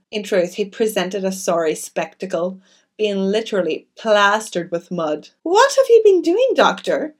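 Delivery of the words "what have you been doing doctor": much higher, louder startled question; crying out. A narrator's reading. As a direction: exclaim at the muddy doctor. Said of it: "cried Missus Cavendish".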